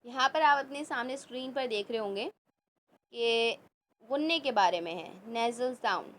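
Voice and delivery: normal talking voice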